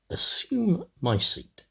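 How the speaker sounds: usual speaking voice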